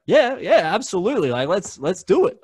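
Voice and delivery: high, loud enthusiastic agreement; enthusiastic yes. Retold or imitated. Agreeing to give Sarah a show, high and enthusiastic. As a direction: jump at the idea.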